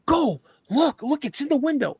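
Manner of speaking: high-pitched frantic command; acted-out panic; panicked urging. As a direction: urge him to look, voice way up. Urging friend to look, panicked.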